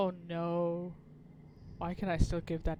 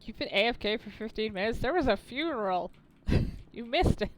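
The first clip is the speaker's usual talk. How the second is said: excited to chat; 3 semitones higher, 6 dB louder than usual. Real, not acted. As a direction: tell chat they missed it all. Streamer reacts to chat, laughing.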